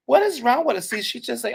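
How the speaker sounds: normal talking voice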